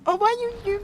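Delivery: extremely high tiny squeak; squeaky joke farewell. Pretend. Streamer pretending to bid YouTube goodbye, squeaky falsetto.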